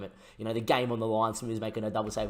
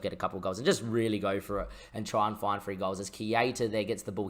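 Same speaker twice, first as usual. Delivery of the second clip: ordinary talk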